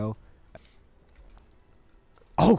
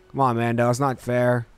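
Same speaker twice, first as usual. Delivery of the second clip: usual speaking voice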